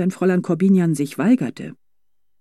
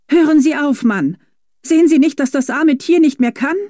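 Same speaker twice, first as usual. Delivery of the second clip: high, loud outcry; outraged protest; 11 semitones higher, 6 dB louder than usual. A narrator's reading. Young lady shouting at the driver to stop, high outraged cry. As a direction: burst in to protect the horse. Girl stops driver beating horse.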